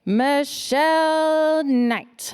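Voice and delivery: drawn-out, loud name call; loud, stretched host announcement; announcer call. Real, not acted. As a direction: announce her like a game show host. Host calls next speaker up.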